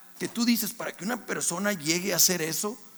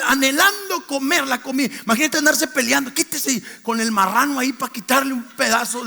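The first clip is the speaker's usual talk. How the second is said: louder, higher sermon drive; full-force preaching; 7 semitones higher, 6 dB louder than usual. Acted. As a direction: preach it hard to the church.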